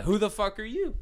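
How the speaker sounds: lightly acted indignation; retold challenge. Retelling his comeback to the stranger.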